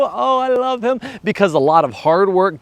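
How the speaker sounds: ordinary delivery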